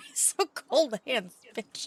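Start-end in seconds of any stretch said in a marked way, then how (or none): none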